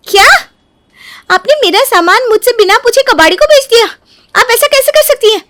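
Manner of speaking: high-pitched indignant protest; outraged. Acted. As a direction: confront her in acted outrage. Acted outrage at sold belongings. (आक्रोश में ऊँची आवाज़ से)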